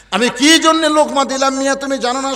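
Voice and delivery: loud indignant retort. Acted. Shepherd challenging the man who scolded him, loud indignant retort. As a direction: throw the question back high and loud.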